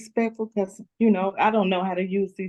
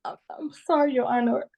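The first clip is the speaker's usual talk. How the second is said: normal talking voice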